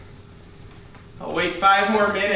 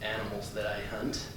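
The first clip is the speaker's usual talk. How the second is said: low, much softer odd hunter voice; deadpan hunter character; 8 semitones lower, 13 dB quieter than usual. Acted. Improv comic to audience, low soft hunter voice. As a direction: mumble it low like a strange hunter.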